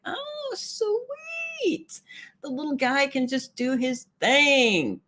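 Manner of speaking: very high, drawn-out coo that eases down; cooing gush. Retold or imitated. Streamer reacting to the little mouse's news, cooing. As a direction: coo over a tiny animal.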